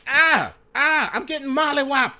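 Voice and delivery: very high, loud comic yelps; hammed-up panicked shriek; yelping in pain. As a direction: squeal it out as if being pummeled. Lawyer is beaten up in game.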